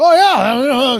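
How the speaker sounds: high, loud mock old-man brush-off; imitating his dad. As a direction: mimic the old man waving off the cops. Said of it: "he'll fucking go".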